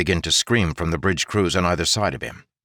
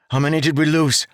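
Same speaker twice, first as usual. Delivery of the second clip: higher, louder worried question; anxious call; 9 semitones higher, 4 dB louder than usual. Acted. Jarek asking the exhausted crew, anxious call. After the battle, counting men.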